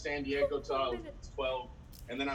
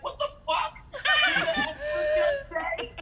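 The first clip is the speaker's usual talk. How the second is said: very high, incredulous cry; shocked outburst; 4 dB louder than usual. Acted. Comic reacting to the expert's answer, shocked outburst. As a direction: shriek it in comic disbelief.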